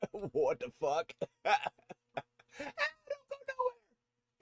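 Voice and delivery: slow, high laughing cry of disbelief. Real, not acted. Laughing in disbelief at the gear finally working. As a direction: laugh it out, almost crying.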